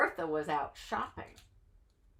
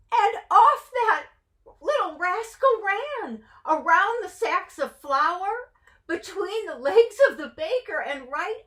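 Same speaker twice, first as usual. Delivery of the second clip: high, loud excited narration; breathless read-aloud excitement; excited storytelling; 10 semitones higher, 6 dB louder than usual. A narrator's reading. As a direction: build the thrill of the chase.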